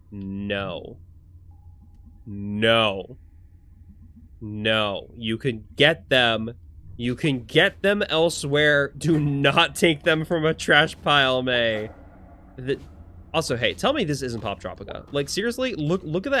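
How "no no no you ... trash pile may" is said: stern grown-up character. Acted. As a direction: forbid it slowly and firmly. Voices refusing game character.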